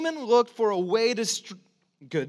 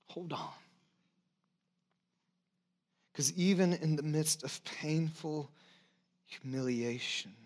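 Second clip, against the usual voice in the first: much softer, lower and tender; soft pastoral comfort; 5 semitones lower, 6 dB quieter than usual. Real, not acted. Pastor softly comforting hurting listeners, tender and low. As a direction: speak gently to those who are hurting.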